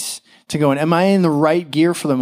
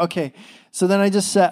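ordinary talk

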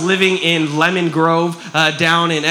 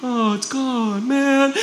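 soft, drawn-out pretend self-pity; mock defeated whine; 3 semitones higher, 4 dB quieter, much slower than usual. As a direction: imitate a victim giving up, softly. Imitates people who give up.

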